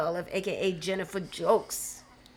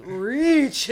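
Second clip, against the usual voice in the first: put-on Scarborough accent; 4 semitones higher, 5 dB louder than usual. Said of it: "in my Scarborough accent".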